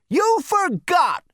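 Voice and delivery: very high, loud, drawn-out accusation; angry old-man snap. A narrator's reading. Great-grandfather cuts off the excuses, snapping shrilly. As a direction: snap accusingly in an elderly screech. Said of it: "snapped Zaphod Beeblebrox the fourth".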